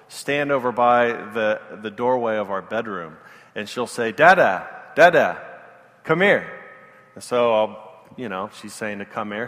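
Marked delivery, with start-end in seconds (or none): 4.1-6.5 put-on loud little-girl call of Dada, falling at the end; toddler calling. Toddler calls dad from the bedroom doorway, loud and insistent. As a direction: call out like a two-year-old wanting her dad.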